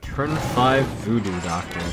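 ordinary delivery

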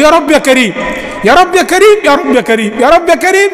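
echoing call. Retold or imitated. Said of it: "وسيدنا النبي وراه يقول".

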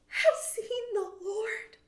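high, loud, slow joyful cry; joyful news. A narrator's reading. Mary tells the disciples in a high joyful cry. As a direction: announce it with wonder. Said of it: "and she told them".

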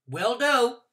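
quick eager reply. A narrator's reading. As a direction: answer fast to get out of trouble. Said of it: "I said".

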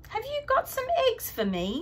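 put-on high cheerful little boy; high child voice. A narrator's reading. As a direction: ask it in a high small voice. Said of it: "says Buster".

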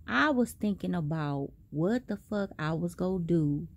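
ordinary delivery

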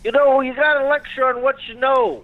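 high, whining put-on voice; Bill Cosby. Comic as Bill discusses teaching a class, high-pitched. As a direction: pontificate in a high comic impression. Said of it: "here, Bill, take the phone".

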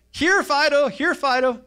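high, loud sing-out to a dog; calling a pet. Retold or imitated. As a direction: imitate a man cheerfully summoning his pet. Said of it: "The guy is actually calling the bear".

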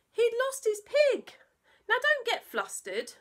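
high, loud bluster; calm everyone down in a fluster; dad's flustered reassurance; fussy dad. Acted.

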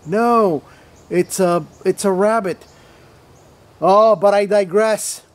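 ordinary delivery

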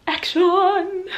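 high, drawn-out director's call; playful "action!" call. Pretend. Opening film-set call.

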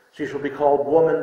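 normal talking voice

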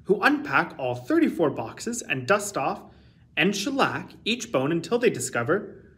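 usual speaking voice

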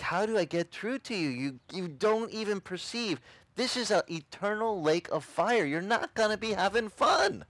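high, strained, insistent pleading; exasperated plea. Retold or imitated. Convert trying to get through to friend, exasperated plea. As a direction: desperately try to get through, pitched high.

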